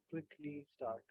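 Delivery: ordinary delivery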